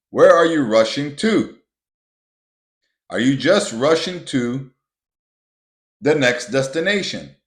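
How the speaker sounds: loud, exasperated lecture on rushing; heated rant. Real, not acted. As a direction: preach it hard at the listener, exasperated. Heated rant against rushing through life.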